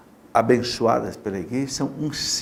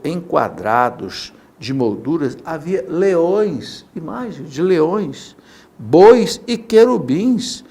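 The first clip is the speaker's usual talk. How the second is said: scripture reading; 3 semitones higher, 7 dB louder, slower than usual. Shown as a demonstration. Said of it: "Vou ler pra você".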